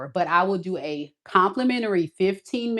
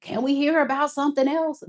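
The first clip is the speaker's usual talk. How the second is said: mock whine, higher; whiny viewer; 8 semitones higher than usual. She mimics viewers tired of love readings, a lightly mocking whine. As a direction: play a bored viewer complaining. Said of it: "some of y'all like".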